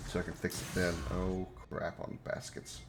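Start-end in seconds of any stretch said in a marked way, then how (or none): 1.1-2.8 muttered curse. Real, not acted. Muttered reaction to dying in game.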